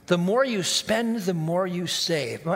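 a slow, dreamy take on the sales line; savoring the slogan. Shown as a demonstration. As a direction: roll the slogan slowly, half-convinced. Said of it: "Man, that sounds good".

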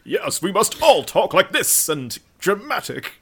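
a teasing fake Shakespearean accent, mocking the Asgardians. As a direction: parody the stagey Shakespearean accent. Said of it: "what accent do they have".

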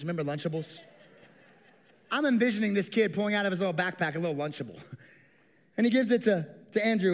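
ordinary talk, nothing marked

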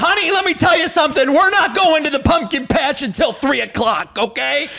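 high mock nagging spouse voice; nagging partner, loud and high; scolding spouse.

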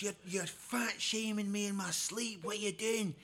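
high, loud mock outrage; angry wife. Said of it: "she's angry".

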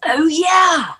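imitating a high cry of joyful revelation; sudden realization. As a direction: let it soar up high, as Dad's dawning wonder. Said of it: "where he suddenly realizes".

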